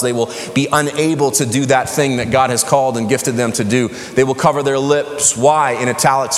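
normal talking voice

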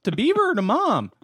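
high mock boy's voice, "Gee, gee, Dad"; Wally voice. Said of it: "Wally's making fun of Beav".

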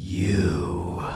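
low, drawn-out "you"; monster's growled quote. Retold or imitated. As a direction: imitate a monster spotting its foe, low. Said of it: "and he goes".

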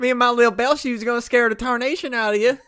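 fast, high southern twang; Phantom Bell man. Pretend. Ghost bellman promising a scare, in a quick southern accent. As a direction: rattle off a folksy mock threat in southern accent.

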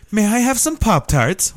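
high, slow mock British accent, prim and proper; posh British accent. Stoned friend politely asking for snacks, mock British accent. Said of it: "super proper when she was stoned".

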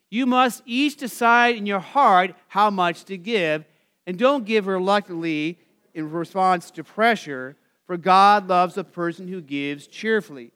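slow, loud reading of scripture; solemn public reading of the verse. Acted. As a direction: read the verse out with weight. Reads scripture aloud.

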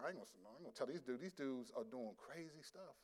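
very soft, low aside; retold private thought, barely voiced; muttered to himself. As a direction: back down under your breath. Recalls private thought, not daring to correct.

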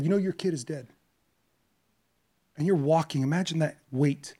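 normal talking voice

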